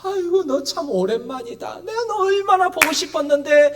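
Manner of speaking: high-pitched, affectionate welcome; acted fond reunion voice; welcoming. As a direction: greet someone long missed, gushing a little. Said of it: "이러시는 게 아니라".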